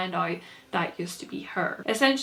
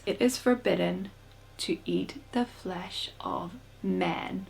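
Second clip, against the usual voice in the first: slow, portentous delivery, growing softer with pauses; ominous pronouncement; 4 dB quieter, slower than usual. Acted. Kai answers what an eater is, slow and ominous. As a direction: intone it gravely, drawing out each phrase. Kai solemnly quotes the law.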